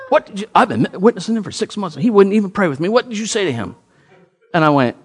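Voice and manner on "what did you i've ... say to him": high, fast, indignant rant; lightly voiced annoyed coworker; a hint of a riled-up coworker; mock angry. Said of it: "He is just fired and mad".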